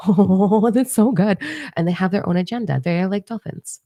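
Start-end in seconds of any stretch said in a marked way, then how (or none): 0.1-1.4 high, giggly gush; mock excitement. Imitating eager newcomers desperate to fit in, mock giddy excitement. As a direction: act overjoyed just to fit in. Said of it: "They're so excited to just".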